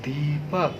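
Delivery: दीपक said softly with weight on the long दी; stressed demo. Shown as a demonstration. Teacher showing pupils the long दी in दीपक. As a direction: lean into दी in दीपक. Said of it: "क्या बोलेंगे".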